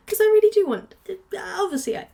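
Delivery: talking through hiccups; hiccuping mid-sentence. Real, not acted. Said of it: "oh, I've got the hiccups".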